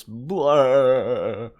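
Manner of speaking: loud imitated sheep noise; sheep bleat. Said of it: "uh, bleats. It goes".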